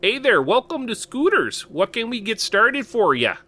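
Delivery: sing-song Minnesotan barista; imitating his own Minnesotan accent at work. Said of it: "I'd try to put on a Minnesotan accent".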